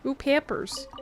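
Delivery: normal talking voice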